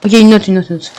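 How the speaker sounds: ordinary delivery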